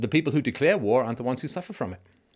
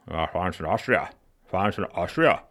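guttural, deep statesman's voice; Churchill; 2 semitones lower than usual. Shown as a demonstration. Narrator demonstrating how to do Churchill, guttural impression. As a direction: let one side of the mouth droop, growl it like a warning. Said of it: "gotta get really guttural and deep".

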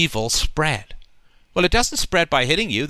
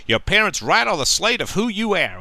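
cheesy talk-show doctor impression, pitched higher; a half-hearted stab at a folksy TV-doctor voice, pitched up; hammy daytime-TV advice voice, higher pitch; Doctor Phil; 5 semitones higher than usual. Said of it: "I can't do his accent".

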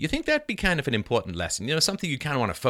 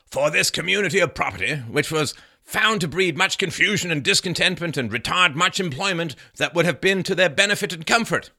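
louder, higher proclaiming voice; declaimed historical quote; 7 semitones higher, 5 dB louder than usual. Acted. Reading Bradford on common property, declaimed. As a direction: read it out like a decree. Reads Bradford's account aloud.

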